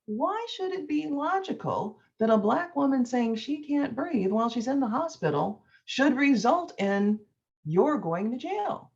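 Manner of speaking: normal talking voice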